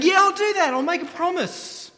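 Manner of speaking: high, loud mock agreement; glib promise. Pharisees promising God with fingers crossed, high mock-eager voice.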